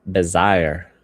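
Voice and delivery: Bezier as a loud 'buh-ZY-er'; anglicized pronunciation. Shown as a demonstration. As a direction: punch the 'ZY' in Bezier, rhyming with buyer. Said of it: "a more southern accent".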